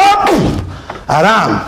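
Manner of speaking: very high sharp "Hopp!"; circus command. Retold or imitated. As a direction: call the jump like a ringmaster.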